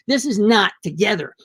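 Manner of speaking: ordinary delivery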